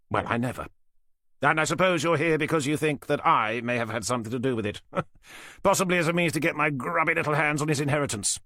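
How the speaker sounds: lightly voiced smug older man. Falcon's held voice.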